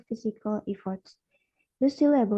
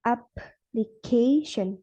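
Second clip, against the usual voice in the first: slow "application", stressing AP and CA; stress on first and third syllables; much slower than usual. Shown as a demonstration. Student showing two stresses says application slowly, stressed on first and third syllables. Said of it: "the stress falls on the first syllable".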